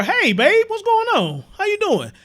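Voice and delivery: high, lightly voiced sweet talk; mocking sweet greeting. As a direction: mimic a fake friendly hello. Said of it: "Light Bearer".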